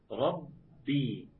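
ربي slow and chanted, with the doubled ب pressed hard and held; doubled b held strong. Shown as a demonstration. Teacher shows students a strong doubled ب in ربي, chanted slowly. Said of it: "بحافظ على الباء عشان تطلع قوية جدًا".

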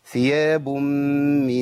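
ثياب من with the nasal held long on من; slow held nasal; four-beat nasal hold. Shown as a demonstration. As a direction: stretch the ghunna of ثياب من slowly. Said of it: "أجيب أربع حركات".

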